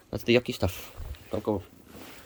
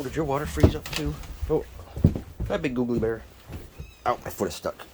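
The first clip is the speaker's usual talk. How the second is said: ordinary delivery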